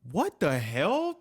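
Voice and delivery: slow, high-pitched, stunned question; real disbelief. Real, not acted. Host reacts to hawk stuck in bumper, stunned. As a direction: stretch it out, rising in bafflement.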